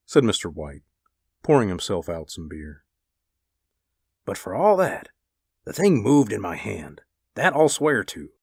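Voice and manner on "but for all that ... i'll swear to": lightly voiced husband, emphatic; a touch of stubborn insistence; insistent husband. A narrator's reading.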